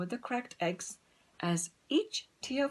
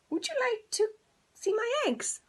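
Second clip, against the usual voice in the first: very high, louder hen ask; 4 dB louder than usual. Acted. Hen asks the cow.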